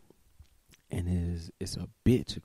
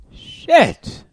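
startled; 15 dB louder than usual. Real, not acted. Startled outburst.

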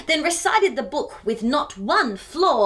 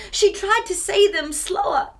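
plain reading voice

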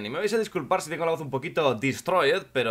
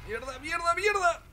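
high squeal climbing in pitch; thin, high yelps of alarm; panicked cursing. Real, not acted. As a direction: curse in a rising panic, without much volume. Panicking under artillery fire.

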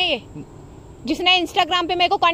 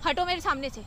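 cold quiet dismissal; 3 semitones lower, 10 dB quieter than usual. Acted. Acted quarrel, girlfriend sending him away.

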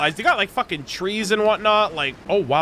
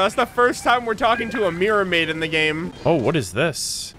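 normal talking voice

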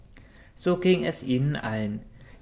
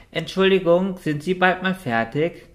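higher, louder playful whine; mock-irritated whining; 6 semitones higher, 5 dB louder than usual. A narrator's reading. Noti in the queue whining at Richard, mock-irritated and higher. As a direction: pretend to be an impatient customer. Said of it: "quengelte sie gespielt gereizt".

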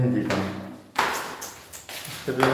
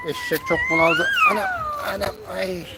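squeezed, strained high voice; strained, half choked. Real, not acted. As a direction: speak while being choked by a hug. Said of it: "beni boğdun hep".

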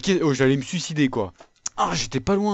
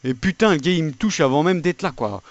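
loud angry rant; raging gamer; 3 semitones higher, 4 dB louder than usual. Real, not acted. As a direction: vent it loud and fast. Raging at game lag.

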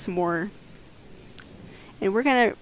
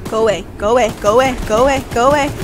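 chanting louder, rising each time; repeated pleading; 3 semitones higher, 8 dB louder, much faster than usual. Real, not acted. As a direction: beg it over and over.

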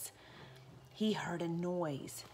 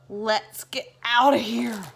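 slow, louder strained escape line; choking burglar; 2 semitones higher, 8 dB louder, slower than usual. Acted. Burglars fleeing the smell, strained and loud. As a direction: gasp it out while fleeing.

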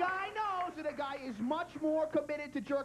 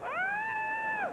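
mock high shriek of terror; terrified scream. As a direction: shriek like the cornered kid. Little guy screaming in terror.

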